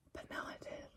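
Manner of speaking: soft unvoiced whisper. Real, not acted. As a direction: breathe it out like a secret. Whispered then repeated aloud.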